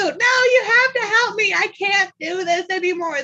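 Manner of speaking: lightly voiced pleading child; mock kid whine. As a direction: whine like a kid who wants help.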